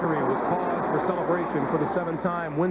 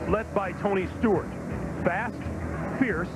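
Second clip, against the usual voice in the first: dramatic sports intro. Acted. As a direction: build the legend with weight. Dramatic intro narration over music.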